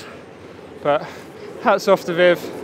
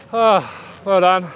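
ordinary delivery

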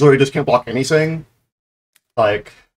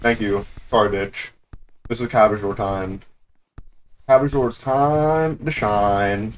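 normal talking voice

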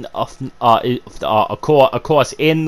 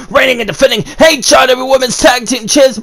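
ring announcer intro; 9 semitones higher than usual. Pretend. Ring announcer style title intro.

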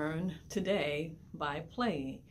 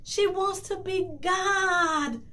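high, slow, desperate cry; cried out; 12 semitones higher, 4 dB louder, slower than usual. A narrator's reading. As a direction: cry it out in terror to the fish. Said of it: "the fisherman cried out".

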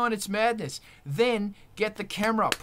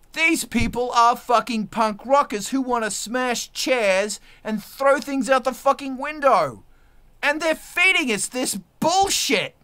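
higher, louder furious rant; shouting down Netflix; 5 semitones higher, 6 dB louder than usual. Real, not acted. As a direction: blast the streaming junk in rising fury.

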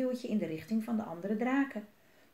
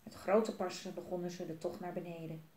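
ordinary delivery, nothing marked

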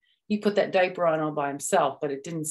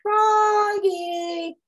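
high, loud long call; 6 dB louder than usual. Acted. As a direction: call him from far away. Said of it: "called his mom".

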